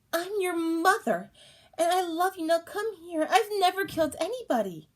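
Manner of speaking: high, loud pleading coax; mother coaxing her child, high and loud. Acted. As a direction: coax the child to come close.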